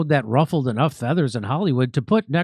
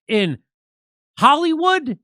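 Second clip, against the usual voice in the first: high, louder incredulous question; mock disbelief; 8 semitones higher, 3 dB louder, slower than usual. Real, not acted. Host doubting that she may work in Hollywood, mock disbelief. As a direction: shoot the word up in sarcastic astonishment.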